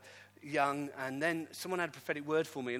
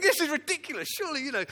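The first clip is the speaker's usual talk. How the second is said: incredulous; 12 semitones higher, 9 dB louder, faster than usual. Retold or imitated. Incredulous thought in dream.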